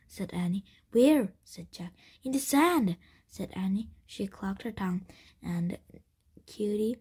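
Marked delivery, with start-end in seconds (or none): 0.9-1.3 loud, high-pitched startled question; urgent question. A narrator's reading. Urgent question as bandits approach. 2.2-3.0 louder, higher, pressing answer; urgent reply. A narrator's reading. Urgent answer as bandits approach.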